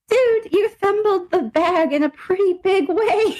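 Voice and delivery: high, drawn-out teasing lament; laughing, incredulous scolding; mock reproach. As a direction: stretch it out high, breaking into laughter.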